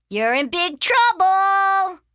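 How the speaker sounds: high, loud, slow singsong; little sister's gloating warning; teasing taunt. Acted. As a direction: stretch it out to needle a brother.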